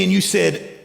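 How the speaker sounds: ordinary talk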